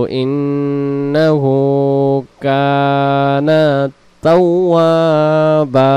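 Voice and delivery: slow, drawn-out chanted recitation; Quran recitation. Shown as a demonstration. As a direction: recite it slowly, stretching the vowels.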